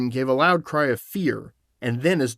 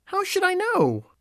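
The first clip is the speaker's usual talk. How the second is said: put-on very high little-girl protest; high-pitched girlish question; Dorothy answering. A narrator's reading. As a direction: protest innocently as a young girl. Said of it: "answered Dorothy".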